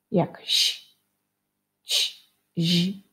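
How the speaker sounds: "ś", "ć", "zi" hissed out as bare sounds; teacher modelling "ś, ć, zi"; isolated soft sounds. Shown as a demonstration. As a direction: make each soft consonant on its own. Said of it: "Pamiętacie zmiękczenia".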